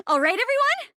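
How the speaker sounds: high, loud rallying check; rallying the group. Acted. Rallying everyone before the performance.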